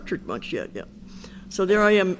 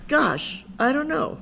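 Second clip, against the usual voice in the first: light, higher, disarming gosh; softened with gosh; 8 semitones higher, slower than usual. Shown as a demonstration. Sponsor shows a polite way to admit not knowing, disarming. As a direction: take the sting out with a gentle gosh. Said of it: "here's what you do. You say".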